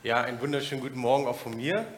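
ordinary talk

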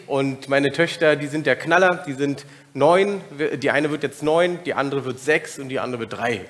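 ordinary talk

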